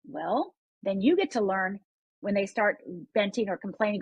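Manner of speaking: ordinary talk, nothing marked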